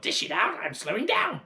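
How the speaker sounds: put-on high, loud old hag demanding; crone yell. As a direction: bark it down at the boy. Grandma demands more.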